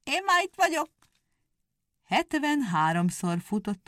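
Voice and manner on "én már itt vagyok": high, gleeful call. A narrator's reading. The wife calls to the hare, high and gleeful. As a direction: call out triumphantly. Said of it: "oda kiáltott a nyúlnak".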